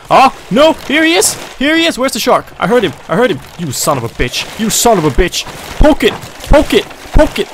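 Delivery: high, loud panicked shouts; frantic yelling. Real, not acted. Gamer fighting the shark, frantic yelling. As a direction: yell at the shark in a frenzy.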